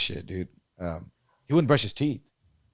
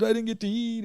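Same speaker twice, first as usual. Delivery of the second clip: much higher, whiny imitation of a cellmate; mock crying complaint; 10 semitones higher, 5 dB quieter than usual. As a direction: whine like he's crying to the guards. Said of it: "crying to the guards".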